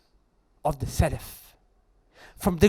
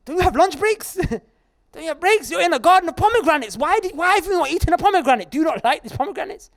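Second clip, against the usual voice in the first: incredulous master; 9 semitones higher, 4 dB louder than usual. Acted. Confused master questions gardener.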